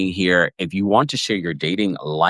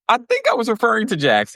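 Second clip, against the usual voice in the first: much higher, airy imitation of a flustered mom; mock Sutton; faster than usual. Retelling Sutton forgetting which kid she meant, much higher. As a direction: recall it with a high, innocent lilt. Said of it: "She's like".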